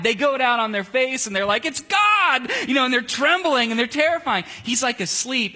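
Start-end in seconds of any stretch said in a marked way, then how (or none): 1.7-2.5 a high, loud cry of "It's God!"; mock-terrified yell; terrified shout. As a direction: shriek it like a terrified worshipper.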